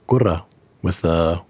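gura with a strongly rolled R. Shown as a demonstration. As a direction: roll the R in gura hard. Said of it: "rolled R's".

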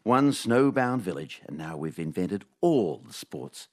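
dry, deadpan storytelling read; comic story read. Acted. Host reading his scripted news-from-nowhere segment, deadpan. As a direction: read the absurd tale like a straight-faced report.

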